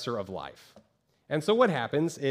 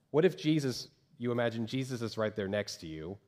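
normal talking voice

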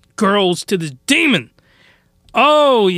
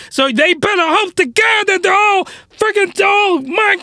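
ranting shout; 9 semitones higher than usual. Real, not acted. Ranting about the school.

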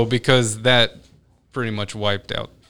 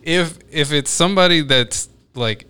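ordinary talk, nothing marked

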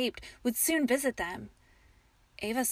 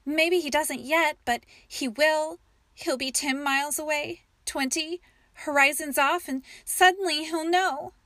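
higher and louder, rising panic; urging him to believe her fear; frightened wife pleading, pitch up; fearful insistence; 5 semitones higher, 6 dB louder than usual. A narrator's reading. Frightened wife insists knight will come.

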